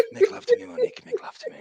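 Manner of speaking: mock seductive voice. Mocking a lover's plea.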